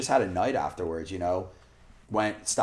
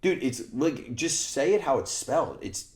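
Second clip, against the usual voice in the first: mock lecturing; 6 semitones higher than usual. Retold lecturing friend.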